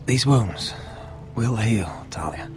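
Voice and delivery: faint, breathy reassurance; weak whisper. Acted. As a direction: whisper through pain. Badly wounded brother reassures.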